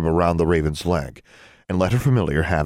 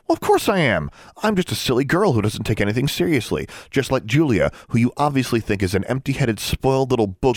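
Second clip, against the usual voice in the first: bitter sarcasm; 6 semitones higher than usual. A narrator's reading. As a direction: throw it back sarcastically. Bitter self-mockery.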